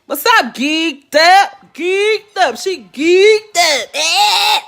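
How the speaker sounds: high, loud hyped greeting with a shout; excited shout-out. Real, not acted. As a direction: yell the name like spotting a friend. Excited shout-out greeting live viewer.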